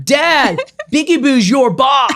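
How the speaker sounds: high, loud mock kid's holler; imitated yell. Mom imitates son yelling over the railing, mock holler. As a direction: call down to Dad like a cheeky son. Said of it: "yells down".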